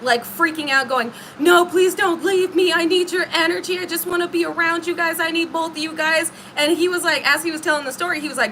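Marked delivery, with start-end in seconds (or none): 1.4-6.3 desperate pleading. Retold or imitated. Wife begging the two men to stay, frantic mock plea. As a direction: beg them not to leave, half-mocking the panic. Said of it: "this woman started crashing out on them, like, freaking out".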